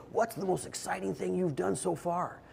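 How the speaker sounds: a hint of a kind schoolteacher drawing kids out; friendly question to kids. Tim asks the kids a question.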